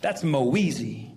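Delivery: "Moeezy" low, with a long "ee"; cranked-up ethnic surname. Acted. Passenger correcting his surname, "Moeezy" low and drawn out. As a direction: draw "Moeezy" out slowly and smoothly. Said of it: "sometimes I crank it up, even more ethnic".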